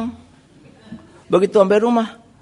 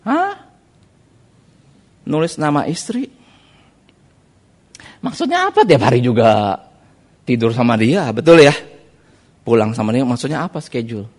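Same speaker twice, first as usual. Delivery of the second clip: baffled; 3 semitones lower than usual. Retold or imitated. Preacher retells his baffled reply.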